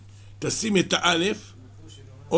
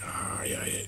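whispered lament; 14 dB quieter than usual. Acted. Disappointment, ball is empty.

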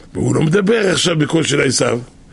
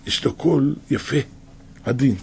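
softer, calm and gentle; 2 semitones lower, 7 dB quieter than usual. Shown as a demonstration. Preacher showing how gentle Jacob speaks, softly. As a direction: speak gently, barely above a breath. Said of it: "יעקב הוא עדין, הוא מדבר".

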